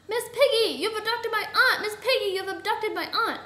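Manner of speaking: high, loud accusing cry; outraged outcry; alarmed accusation. A narrator's reading. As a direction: accuse her in loud dismay. Accusing the kidnapper of his aunt.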